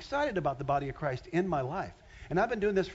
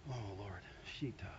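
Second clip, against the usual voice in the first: quiet aside; 8 semitones lower, 14 dB quieter than usual. Real, not acted. Pastor loses his place in text.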